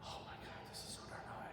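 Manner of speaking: whispered, breathless mock panic. Director imitates the recording choir straining, whispered. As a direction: whisper it like a choir gasping at the note. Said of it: "they're like".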